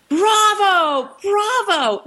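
high, drawn-out mock cheer; imitated yell. Imitating an outburst in the cathedral, high mock yell. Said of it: "She is yelling, 'Bravo, bravo.'".

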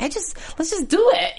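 high-pitched imitation of an eager plea; pleading quote. Co-host voicing the bride urging the wedding, pleading. Voices Kat pleading to marry.

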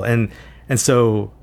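ordinary delivery

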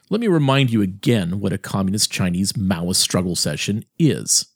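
ordinary delivery

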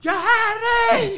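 high, slow, piercing yell of the name; woman's shout. Retold or imitated. Woman on the runway plane yelling for the comedian. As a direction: shriek it across the plane cabin. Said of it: "una mujer gritó".

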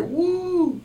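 high-pitched "woo"; imitated whoop of a cheering crowd. Retelling a crowd cheering a dancing girl, mock high cheer. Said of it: "Everybody's cheering for her".

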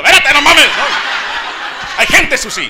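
high, loud embarrassed protest; mock alarmed protest. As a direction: push her off in public. Novia lo presiona en público. (protesta apenada burlona, aguda y fuerte)

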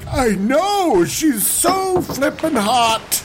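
high goofy Pharaoh bragging to Abram; smug Pharaoh bro. Pretend. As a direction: brag like a smitten dude.

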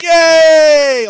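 long, high shouted cheer; cheering crowd. Retold or imitated. Audience applauding Riker's shove, loud mock cheer. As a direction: imitate a whole audience cheering wildly. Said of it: "the crowd's just like".

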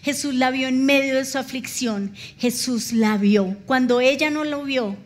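usual speaking voice

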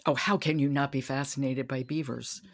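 ordinary delivery

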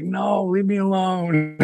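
lightly voiced drawn-out sleepy protest; slow, a hint of a groggy whine; half-asleep wife. Wife being woken, retold.

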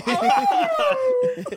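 very high, loud howling wail; wolf howl. Retold or imitated. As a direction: throw your head back and howl.

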